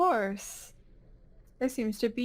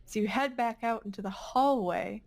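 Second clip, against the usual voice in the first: ordinary talk, nothing marked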